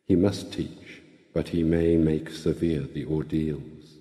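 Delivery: usual speaking voice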